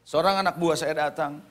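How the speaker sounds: ordinary talk, nothing marked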